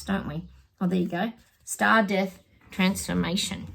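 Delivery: ordinary talk, nothing marked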